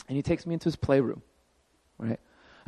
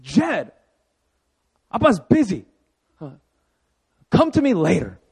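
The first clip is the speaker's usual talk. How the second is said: brushing off son; 4 semitones higher, 4 dB louder than usual. Pretend. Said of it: "And I'm tempted".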